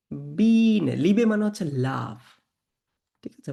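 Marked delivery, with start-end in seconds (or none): 0.1-0.9 বিনে with a long loud "ee"; German pronunciation demo. Shown as a demonstration. Teacher models German word to class, বিনে drawn out loudly. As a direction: hold and push the first vowel of বিনে. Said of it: "উচ্চারণ করতে হবে".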